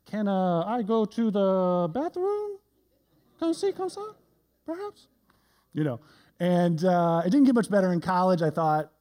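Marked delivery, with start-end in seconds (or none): 0.1-5.1 mock French accent. Shown as a demonstration. Student asking for the bathroom in French class, bad French accent. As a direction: stretch it out in a silly fake French accent, squeaking up high. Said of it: "English words with a French accent".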